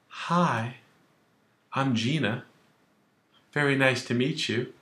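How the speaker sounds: soft, sweet polite reply; polite girl. Retold or imitated. Young lady politely greeting Vito, soft and sweet.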